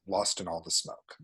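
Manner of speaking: ordinary talk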